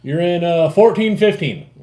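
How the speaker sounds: high, loud quoted announcement; imitating Chris. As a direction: act out someone calling out a spot number. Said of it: "He goes".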